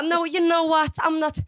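high, loud flustered English; panicked backpedalling. Retold or imitated. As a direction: act out hasty face-saving. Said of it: "då får jag sån panik".

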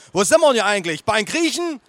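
protesting grandma. Acted. Grandma unsure of restaurant, loud complaint. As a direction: ask it in loud bewilderment.

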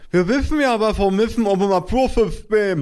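very high, muffled lisping mumble; mumbling mother. A narrator's reading. Mother to environment officer, high muffled mumble. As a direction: mumble high through a swollen mouth. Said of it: "murmelte meine Mutter".

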